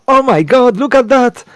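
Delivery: high, loud excited squeal; thrilled woman seeing a toy dance; excited delight. Acted. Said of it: "she starts to laugh".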